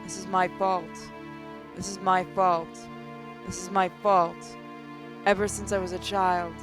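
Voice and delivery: normal talking voice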